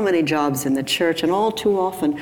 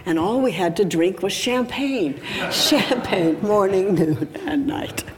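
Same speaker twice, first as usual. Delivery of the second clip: lilting, higher mock grumble; mock complaint; 4 semitones higher than usual. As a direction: make a jokey moan of a luxury. Mock complaint about only champagne.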